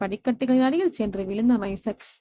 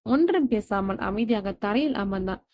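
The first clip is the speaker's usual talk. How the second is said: usual speaking voice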